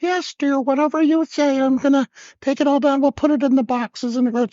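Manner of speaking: very high, meek yes-dear voice; hammed-up henpecked compliance; mock obedient husband. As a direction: give in at once in a squeaky high pitch. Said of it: "I smartly, I went".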